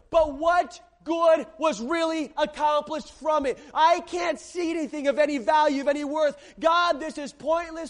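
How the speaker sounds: loud, high, driving pulpit preaching; preaching at full force. Acted. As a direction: preach it with fire to the whole church. Preaching Elijah's despair at full force.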